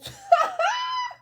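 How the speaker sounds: real loud squealing laugh; helpless high laugh. Real, not acted. Reader finds this person funny, loud squealing laugh.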